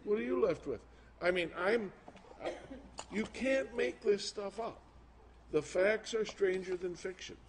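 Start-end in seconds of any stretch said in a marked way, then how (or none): none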